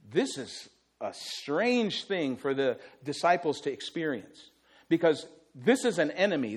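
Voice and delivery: ordinary talk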